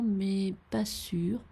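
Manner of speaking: usual speaking voice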